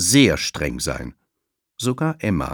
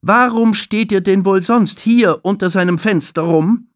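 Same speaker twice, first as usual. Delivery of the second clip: high, loud stern question; strict receptionist scolding; stern interrogation; 13 semitones higher, 9 dB louder than usual. Acted. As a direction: grill the children. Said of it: "fragt Tulile".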